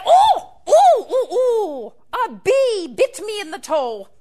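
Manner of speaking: high yelping cries after a sting; pained yelp. Acted. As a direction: yelp it, then settle into a whine. Leprechaun stung by a bee.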